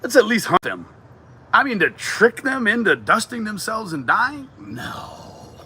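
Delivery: excited outburst. Real, not acted. Laughing outburst against chicken poison.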